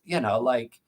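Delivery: normal talking voice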